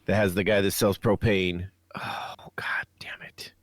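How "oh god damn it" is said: whispered curse under the breath; frustrated whisper. Real, not acted. Host whispers in frustration at forgetting a title. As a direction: hiss it to yourself, slow, can't find the word. Can't recall a show's name.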